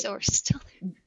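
breathy unvoiced whisper. Real, not acted. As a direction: whisper it to yourself. Unvoiced aside.

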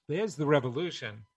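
ordinary talk

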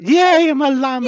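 high excited shout, very loud. Retold or imitated. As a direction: shout it with glee. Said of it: "will shout".

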